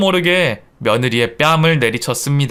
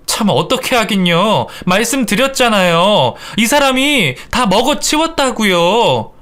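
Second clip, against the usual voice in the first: higher, impatient explanation; annoyed insistence; 7 semitones higher than usual. Acted. Daughter-in-law telling mother-in-law who ate it, annoyed. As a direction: insist with rising irritation.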